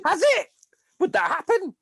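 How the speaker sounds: mock her in a shrill falsetto; mocking the medic instructor in a high sarcastic voice.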